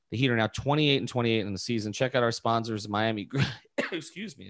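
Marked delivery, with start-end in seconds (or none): 3.7-4.5 hoarse after a cough, clearing the throat; choking. Real, not acted. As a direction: say it while still choking a little. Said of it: "as I'm choking here".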